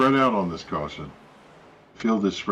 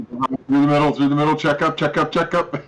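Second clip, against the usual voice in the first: urgent spotter calls, higher and louder; race spotter radio; 7 semitones higher, 5 dB louder, faster than usual. Acted. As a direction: bark quick warnings to the driver. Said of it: "It would be cool to have spotter audio".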